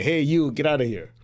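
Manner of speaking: ordinary talk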